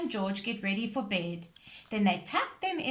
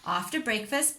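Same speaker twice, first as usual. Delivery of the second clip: ordinary delivery, nothing marked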